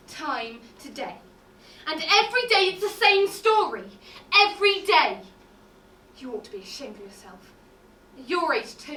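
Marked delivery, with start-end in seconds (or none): 1.9-5.2 scolding outburst. Acted. Maid scolds professor.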